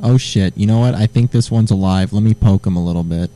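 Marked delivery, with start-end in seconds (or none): none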